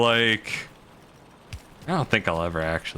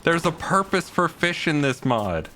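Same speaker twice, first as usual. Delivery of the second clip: mock desperate pleading; 8 semitones higher than usual. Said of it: "desperate to be like".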